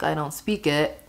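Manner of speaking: normal talking voice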